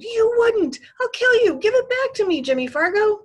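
very high, loud, furious shriek; screamed. A narrator's reading. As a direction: scream it like a girl in a frantic rage. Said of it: "Sheila screamed".

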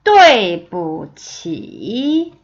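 对不起 very slow, sharp fall then a dip and rise; tone demonstration. Shown as a demonstration. Teacher modelling the whole phrase, 对不起 slow and toned. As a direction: hit 对, drop, then stretch 起 through its dip. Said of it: "들어보세요".

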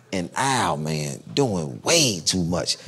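normal talking voice

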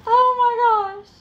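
high, loud, drawn-out oh my gosh; overwhelmed exclamation. Real, not acted. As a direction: squeal it out, swamped by the mess. Overwhelmed by craft mess.

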